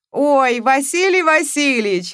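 high, loud, slow other voices; a chorus of rowdy guests; guests' voices. A narrator's reading. Guests chorus his name. (гости хором, высоко и громко)

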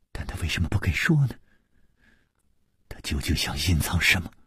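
low, hushed, rapid questioning; quiet questioning. A narrator's reading. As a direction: press for the answer under your breath. Interrogator, continuing the soft threat.

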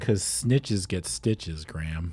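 ominous warning. Pretend. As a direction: lay it down slow and low like a threat. Ominous punchline to co-host.

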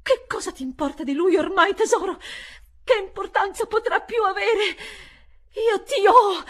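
high, loud defiant cry; triumphant shout. Acted. Governess shouting at the unclean being, triumphant cry. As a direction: hurl it at the demon. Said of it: "gridai rivolta all'essere immondo".

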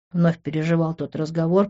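plain reading voice

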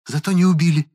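high-pitched, sharp retort; snappish. A narrator's reading. Woman to boy blaming her, snappish. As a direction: snap back defensively in a high voice. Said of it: "огрызнулась она".

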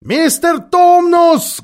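high, slow, loud cry of his name; Lucy yelling to stop the Faun's sobbing; loud shout. A narrator's reading. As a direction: shout it right into his ear. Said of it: "громко закричала Люси".

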